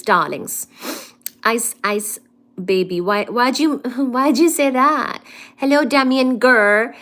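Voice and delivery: ordinary talk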